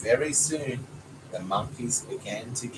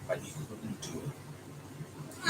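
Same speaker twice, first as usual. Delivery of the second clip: slow, much softer whisper; whispered sadness; 3 semitones lower, 12 dB quieter, much slower than usual. A narrator's reading. Dad telling how the creature gave up, whispered slowly. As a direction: breathe it out sadly and slowly. Sad moment in the story.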